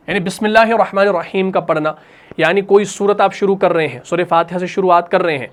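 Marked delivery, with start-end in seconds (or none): none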